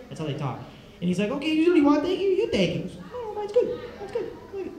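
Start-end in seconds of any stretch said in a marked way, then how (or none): 1.3-2.8 high-pitched mock indignant offer; mock squabbling. Acts out brothers squabbling over iPod. 3.1-4.7 very high, small squeaky protest; mock backing down. Brother backs down in the squabble.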